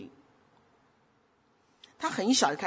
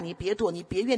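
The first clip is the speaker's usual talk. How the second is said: ordinary talk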